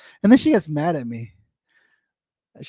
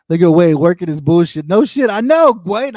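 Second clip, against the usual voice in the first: laughing outburst; 10 dB louder than usual. Real, not acted. Laughing outburst at Wade's comment.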